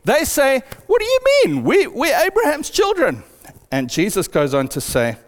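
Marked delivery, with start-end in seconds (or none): 0.9-3.2 indignant surprise. Retold or imitated.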